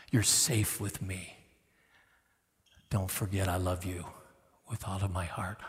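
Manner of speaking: soft, slow, hushed reassurance; tender father voice. Acted. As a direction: comfort a frightened child gently. Father speaking tenderly to child.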